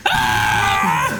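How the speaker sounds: loud imitated scream of panic; mock freak-out scream. Retelling a star attacked by surprise, loud panicked scream. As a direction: scream as if freaking out, full volume. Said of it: "Started freaking out".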